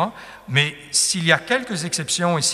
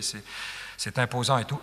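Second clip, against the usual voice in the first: usual speaking voice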